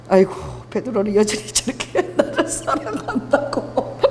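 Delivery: slow, breaking into laughter; amused mock sigh. Jesus watching Peter splash toward him, amused and laughing. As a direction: marvel indulgently, then laugh through it.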